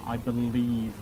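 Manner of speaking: normal talking voice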